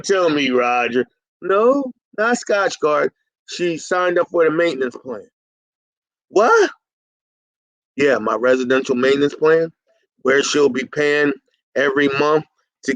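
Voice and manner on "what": sharp high disbelieving "What?"; a stunned one-word yelp of disbelief; surprised. Real, not acted. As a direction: blurt it out in shock, way up high. Host reacts to surprising news.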